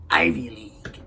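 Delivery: low, voiceless, mocking aside; sarcastic whisper. Real, not acted. Host mocking her snobby Ivy League town, sarcastic whisper. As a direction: hiss the name like a snide joke.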